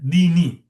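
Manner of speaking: "dini" said loud, ending in a long i; kasra on the noon. Shown as a demonstration. Teacher stressing the word for my religion, loud voweled "dini". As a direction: hit "dini" hard with its final i sounded. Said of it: "That kasra actually means my religion".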